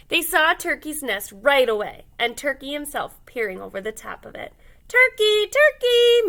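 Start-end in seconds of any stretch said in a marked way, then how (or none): 4.9-6.3 high, loud sing-song call; sweet calling. A narrator's reading. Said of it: "called in his sweetest voice".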